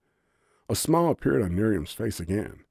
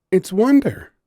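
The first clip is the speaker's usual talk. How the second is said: higher, louder, full of bliss; dreamy delight at her home; blissful; 14 semitones higher, 10 dB louder than usual. A narrator's reading. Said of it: "voice full of bliss".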